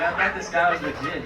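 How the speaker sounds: real laughter breaking into the line; laughing. Real, not acted. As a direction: crack up as you say it. Laughing retelling a funny memory.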